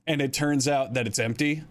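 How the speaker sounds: normal talking voice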